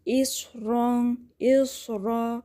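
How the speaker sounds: slow recitation with heavy, thick ra; heavy letters. Shown as a demonstration. Teacher shows the heavy ra in recitation. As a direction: thicken the رنگ and را, drawn out. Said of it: "میں موٹا پڑھ رہی ہوں".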